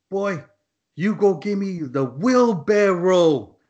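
high, drawn-out order; choppy command. Retold or imitated. Foreman sending a worker for the wheelbarrow, slow choppy command. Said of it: "real shoppy the way he's telling me".

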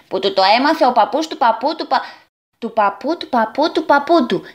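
rattled off in a sing-song rush; impatient. Acted. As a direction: finish the friend's boast impatiently, racing through the repeats. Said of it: "συμπλήρωσε ανυπόμονα ο Ιζό". (ανυπόμονα)

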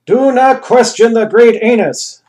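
offended ruler scolding the secretary, angrily; angry command. Acted. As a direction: rebuke them from on high. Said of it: "the Anus angrily answered".

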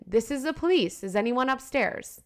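calling out. Acted. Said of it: "He calls out again".

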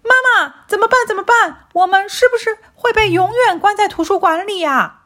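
put-on high, anxious child; worried puppy. A narrator's reading. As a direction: panicky little kid. Child panics about being locked in.

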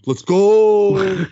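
loud, high pumped-up "let's go"; imitating a dumb frat-boy cheer; hyped bro. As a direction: fire it off like a gym bro getting hyped. Same hyped bro reply retold.

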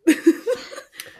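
loud squealing laugh; high burst of laughter. Real, not acted. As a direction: shriek with laughter.

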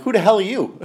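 high-pitched confrontational question, not shouted; retold aggressive challenge. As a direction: challenge a stranger, reenacted. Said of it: "you were so aggressive".

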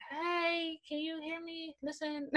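soft, shy, barely there; timid quiet voice. Retold or imitated. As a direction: shrink back and ask meekly. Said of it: "Now I'm like".

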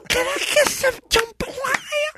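very high, hammed-up Brooklyn whine; over-the-top high Brooklyn impression; Brooklyn accent. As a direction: squeal it in a mock Brooklyn accent. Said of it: "here's my turn".

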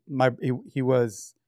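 ordinary talk

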